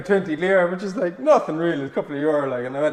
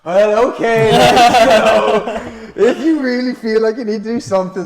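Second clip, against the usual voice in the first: louder, higher, laughing along; laughing reluctant okay; 7 semitones higher, 10 dB louder, slower than usual. Retold or imitated. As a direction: give in with a laugh. Reluctant agreement.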